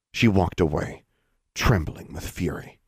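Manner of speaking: usual speaking voice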